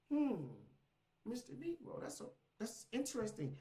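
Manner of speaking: high, softly musing mock voice; mock intrigued. Snooping AI pondering the user's business idea, mock intrigued. Voicing the AI eavesdropping on his idea.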